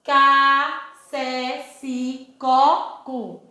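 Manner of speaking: each syllable said slowly and apart, teacher style; slow, careful classroom syllable chant; syllable drill. Shown as a demonstration. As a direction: spell out the C family syllable by syllable. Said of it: "Então, olha só".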